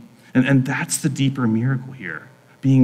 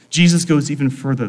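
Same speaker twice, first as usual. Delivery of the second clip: ordinary talk, nothing marked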